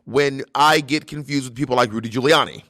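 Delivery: flustered senator impression, heavy accent; accented, emphatic senator defending himself; Bernie Sanders. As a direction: protest haltingly as the offended politician. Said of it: "continue the interview as this person".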